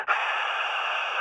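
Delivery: soft unvoiced shush. A narrator's reading. Attacker covers his mouth and hushes him, a soft shush. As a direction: hush him with a quiet sch. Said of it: "hörte ich".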